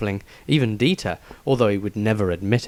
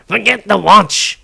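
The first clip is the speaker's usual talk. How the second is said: loud, higher sharp snap; snapped; 8 semitones higher, 7 dB louder than usual. Acted. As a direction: cut them off sharply. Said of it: "snapped Tobias".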